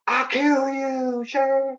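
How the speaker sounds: high, slow playful threat; hammed-up little sister threatening to kill; slow high teasing menace; mock threat. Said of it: "She's like".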